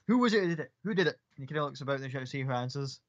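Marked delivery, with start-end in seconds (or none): 0.1-1.2 louder, higher eager questioning; the soldier looking round for the culprit; eager Gith soldier. Acted.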